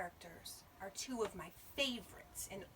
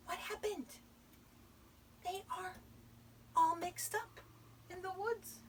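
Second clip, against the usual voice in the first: high, halting questioning; puzzled wonder; 10 semitones higher than usual. A narrator's reading. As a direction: wonder aloud, phrase by phrase. Narrator puzzled at the mix-up.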